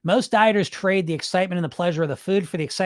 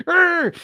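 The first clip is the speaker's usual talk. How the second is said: laughing, pitched-up groan of exasperation; frustrated growl; 12 semitones higher, 4 dB louder than usual. Real, not acted. Coach groans at himself for getting worked up. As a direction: let out a worked-up laughing urgh. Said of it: "I get all worked up".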